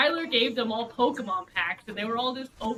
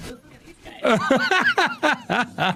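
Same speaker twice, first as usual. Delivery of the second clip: laughing mock quote; 3 semitones higher, 7 dB louder, much slower than usual. Imitating the other table's reaction.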